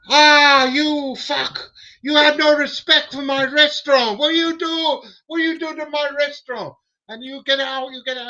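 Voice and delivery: high, loud furious chef with a mocking accent; angry chef. Angry chef with machete, imitated.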